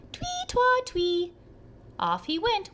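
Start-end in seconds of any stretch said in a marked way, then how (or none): 0.1-1.3 much higher sung whistle twee twa; whistle imitated. A narrator's reading. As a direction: chirp it like a whistle.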